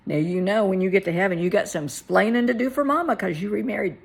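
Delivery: loud, playful mock warning; jokey telling-off, rising in volume; joking. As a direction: tease him with affectionate scolding. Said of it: "I was joking him".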